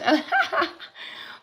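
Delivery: high giddy giggle rising up; a burst of happy laughter; delighted laugh. Real, not acted. Delight at her own sign.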